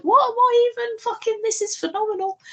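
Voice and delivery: very high-pitched, hammed-up astonishment; amazed reaction. Viewer sitting up in the cinema, re-enacted high-pitched amazement. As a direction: squeal it like a stunned viewer, re-enacted. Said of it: "made me genuinely sit up in the cinema".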